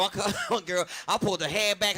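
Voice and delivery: ordinary delivery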